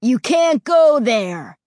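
loud, slow, insistent; forbidding. Acted. Ray forbidding the boys from the cemetery, loud and slow. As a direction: hammer the warning home.

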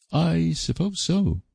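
high, slow, offhand shrug of a reply; shrugging indifference. A narrator's reading. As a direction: let it drop with a careless shrug, slowly. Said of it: "said Stella, shrugging her shoulders".

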